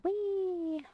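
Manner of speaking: high, long gliding squeal; playful glee. Real, not acted. Player making a winning move, high gleeful whee. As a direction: let it sail up high like a ride.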